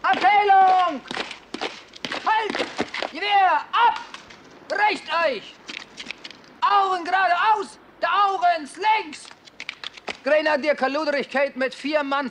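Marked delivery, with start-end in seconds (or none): 0.0-9.3 german drill sergeant. Acted. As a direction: shout the drill orders across the yard. Corporal barks parade commands.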